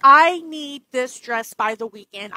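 loud, slow angry demand; furious slow yell. Acted. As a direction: yell each word slowly at the agent. Customer yells at agent.

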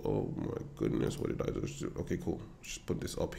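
soft, half-whispered oops; muttered to self. Real, not acted. Trader mutters to himself after messing up his chart, soft and half whispered. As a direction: quietly caught out by his own mistake.

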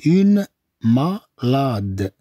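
very slow, broken-up "Une malade"; drawn-out teaching pronunciation; syllable by syllable. Shown as a demonstration. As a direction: stretch "Une", then split "malade". Slow take for learners.

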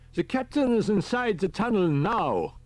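put-on high, fussy elderly professor; a high old professor calling on the radio; professor's voice. A narrator's reading. Riker on the radio.

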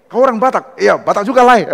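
light acted joy at finding a countryman; excited greeting. Student abroad greeting a fellow Batak, excited.

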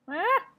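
sharp high yelp then loud burst of laughter; yelp, then crack up laughing; startled yelp into laugh. Real, not acted. Gamer yelps at creature, then laughs loudly.

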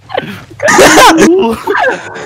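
nervous high laugh. Real, not acted. Nervous laughing after scare.

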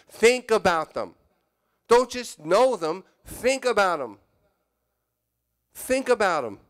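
full-voiced preacher's exhortation; preaching emphasis. Real, not acted. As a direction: drive the point home to the congregation. Preacher drives home the verse.

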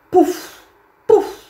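high, loud puffs; cartoon plop sounds; puff noises. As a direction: pop out each puff. Horse demonstrates droppings.

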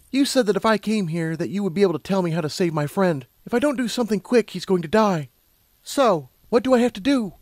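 high-pitched pleading urgency; Lumina, urgent. A narrator's reading. Lumina asking how to save her friend, urgent. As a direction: press for an answer, pitched high.

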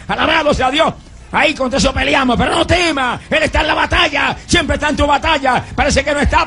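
rally the congregation, shouting; preaching at full force. Acted. Preacher urges the church to fight the battle, fiery shout.

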